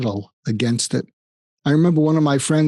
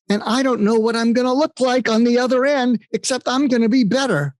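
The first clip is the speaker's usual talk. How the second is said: much higher, lit-up retelling of the friend; giddy excitement; 10 semitones higher than usual. Retold or imitated. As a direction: sound giddy about what comes next. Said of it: "I'm giddy with excitement".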